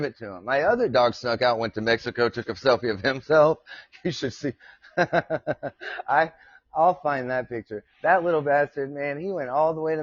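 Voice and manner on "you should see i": laughing. Real, not acted. Laughs recalling dog's selfie.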